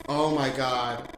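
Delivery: loud, slow startled oh my god; jolted by a sudden scare; real loud shock; startled cry. Real, not acted. Sudden scare in horror game.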